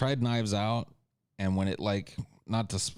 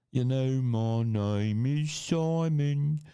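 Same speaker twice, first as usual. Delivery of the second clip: slow, high, stretched-out character voice; put-on sing-song introduction, very slow; Simon impression; 4 semitones higher, much slower than usual. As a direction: draw out each phrase in a silly raised voice. Sudden impression of a named character.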